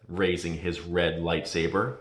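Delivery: plain reading voice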